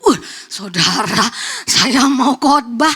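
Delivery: drawn-out, labored words of distress; distressed groan. Retold or imitated. Preacher recalls the alarming message before preaching, distressed and drawn out. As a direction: drag it out heavily, reliving the shock.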